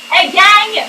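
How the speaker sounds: rally the group, pushed up and loud; camp monitor. Pretend. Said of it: "C'est la monitrice de quinze jours". (monitrice qui lance un appel aigu et fort)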